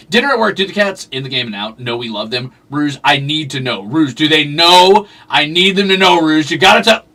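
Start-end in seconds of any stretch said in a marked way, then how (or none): none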